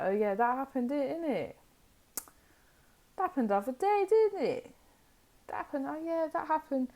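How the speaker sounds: high, sing-song mock remembering; airy feigned recollection. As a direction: toss it off high, like it barely registers. Acting out suddenly remembering an old betrayal.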